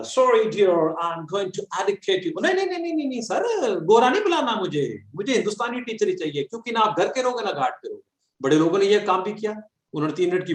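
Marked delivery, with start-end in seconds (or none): 0.0-2.4 high, slow put-on posh English; mock foreign accent. A lightly mocked foreign teacher addressing Indian students. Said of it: "US, UK English". 2.4-8.0 high, fast pleading no no no; protesting student. Retold or imitated. Students pleading with the teacher in a high protesting voice.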